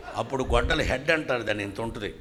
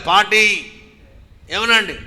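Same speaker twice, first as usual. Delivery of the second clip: loud plea; 7 semitones higher, 13 dB louder, much slower than usual. Retold or imitated. Pleading pastor.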